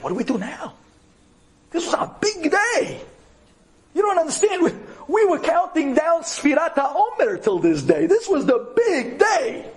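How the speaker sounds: high-pitched outcry of panicky disappointment; dismayed friends. Retold or imitated. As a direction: act out friends protesting in disbelief, pitch way up. Friends' outcry at breakdown retold.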